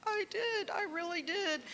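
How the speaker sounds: high, choked-up retelling of a tearful yes; tearful reply. Retold or imitated. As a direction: answer the stranger as if close to tears. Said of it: "And I'm like".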